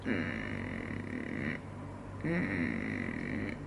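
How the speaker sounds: deep creaky rattling hum; vocal fry. Shown as a demonstration. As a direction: let the voice crackle lazily on a low hum. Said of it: "it sounds like this".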